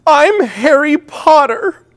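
high, loud, slow wailing cry; wailing child. Retold or imitated. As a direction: imitate a child crying out in dismay. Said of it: "he began to wail and cry as he said".

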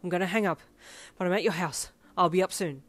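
loud, breathless rasp; winded, hurried reassurance. A narrator's reading. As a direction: rasp it urgently into the phone. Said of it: "Ida rasped".

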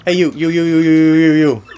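mock-calling someone over and over. Player imitating a character calling out loud.

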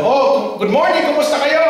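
cheerfully greeting the silent priests; bright greeting. Retold or imitated. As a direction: break the gloomy silence cheerfully.

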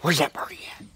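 soft playful whisper; quiet whisper to coax the dog. Real, not acted. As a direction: whisper to tease the puppy. Teasing the dog with a toy.

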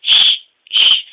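loud, sharp shushes; hushing. A narrator's reading. Emily quieting the engines, hushing. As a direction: shush them firmly.

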